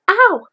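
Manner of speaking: high, loud yelp of pain; cry of pain. Acted. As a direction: yelp as if tugged too hard. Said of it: "cried Pooh".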